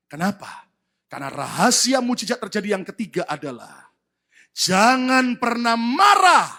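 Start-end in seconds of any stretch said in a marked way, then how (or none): 4.5-6.5 slow, loud drawn-out words; slow, loud preaching emphasis; slow emphatic. Real, not acted. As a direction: hammer the point slowly. Preacher stresses key point.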